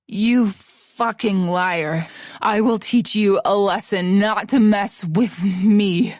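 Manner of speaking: very slow, heavy and menacing; seething rage. Acted. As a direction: grind out the threat. Nick threatening.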